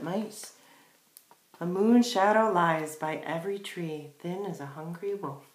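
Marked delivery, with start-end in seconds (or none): none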